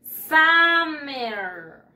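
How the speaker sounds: "Summer" stretched loud into SAAH-merr; pronunciation model. Shown as a demonstration. Teacher has pupils repeat after her, "Summer" as slow SAAH-merr. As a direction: draw out both syllables of "Summer", first an open ah. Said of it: "Repeat after me".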